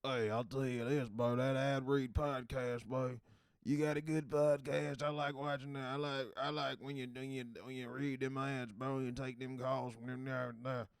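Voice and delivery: loud, hammed-up country accent. Country fan praising the ad read podcast, exaggerated country accent. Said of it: "try something a little more country".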